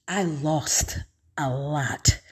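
heavy-hearted murmur; quiet sorrow. Real, not acted. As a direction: let it sink, slow and soft. Repeat, sinks softer and slower.

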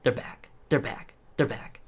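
a prince lost in his own head, softly muttering; creepy murmur. Acted. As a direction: murmur it to himself like a madman. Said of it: "you can just hear him go".

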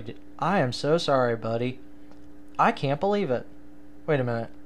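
normal talking voice

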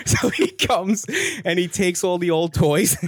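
high, laughing through the words. Real, not acted. Telling the host about Repo the Elf, laughing. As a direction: giggle out the story.

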